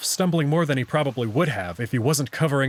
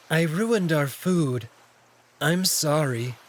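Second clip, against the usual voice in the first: a bashful apology, slowed down; shy cat Alex; 2 semitones higher, slower than usual. Acted. Narrator voices shy Alex.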